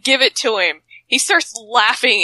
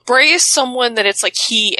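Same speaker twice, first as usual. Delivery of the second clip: ordinary talk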